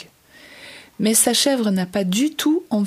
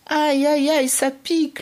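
higher yelps of pain; ouch of pain; 5 semitones higher than usual. Acted. Stepping barefoot on thorny plants, higher pained yelps. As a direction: yelp as the thorns bite.

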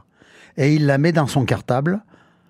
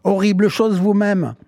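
high, sustained storybook retort; indignant cry; 7 semitones higher than usual. A narrator's reading. Witch on the headmaster's desk, crying out indignantly. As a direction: throw the insult back, crying out. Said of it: "en criant".

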